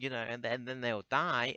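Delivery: ordinary talk, nothing marked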